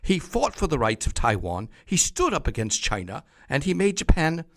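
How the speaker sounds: hammed-up posh British newsreader, loud and high; mock English accent, booming praise; fake English accent. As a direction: put on an over-the-top English accent. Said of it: "in my fake English accent".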